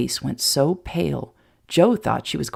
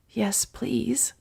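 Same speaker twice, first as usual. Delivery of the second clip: soft whisper; 6 semitones higher, 6 dB quieter than usual. A narrator's reading. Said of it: "whispered Mary Sue".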